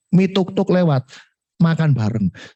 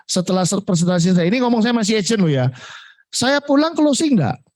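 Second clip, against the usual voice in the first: ordinary delivery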